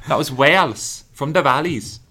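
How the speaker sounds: Welsh accent. Shown as a demonstration. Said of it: "I'm not good with a Welsh accent".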